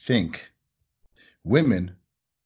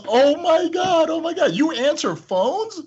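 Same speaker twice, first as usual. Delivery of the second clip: much higher, slow, louder squeal; amazed fan; 4 dB louder than usual. Retold or imitated. Caller stunned that the owner answers phones, squealing.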